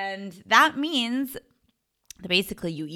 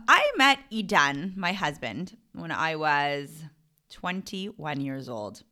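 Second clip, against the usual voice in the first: usual speaking voice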